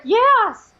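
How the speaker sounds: high, loud acted yes; emphatic agreement. Woman agreeing that demons are horrible, emphatic agreement.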